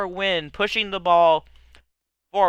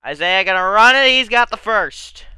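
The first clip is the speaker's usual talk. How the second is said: play-by-play; 4 semitones higher, 7 dB louder than usual. Acted. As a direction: call the run like an announcer. Calls the run.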